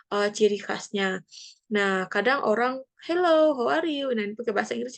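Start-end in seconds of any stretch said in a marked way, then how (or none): 3.0-4.1 high-pitched chirpy greeting; sapaan ceria. Acted. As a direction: act out a lively English hello. Meniru sapaan orang di chat. (sapaan riang bernada tinggi)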